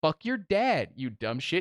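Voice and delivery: half-hearted retold yell; mock angry comeback. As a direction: hurl it back in mock fury. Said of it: "we scream at each other".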